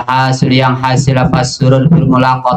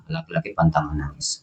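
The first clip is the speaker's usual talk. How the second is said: the maxim said again much softer, almost under the breath; hushed repeat; 7 semitones lower, 13 dB quieter than usual. Shown as a demonstration. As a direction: drop the voice right down to let it sink in.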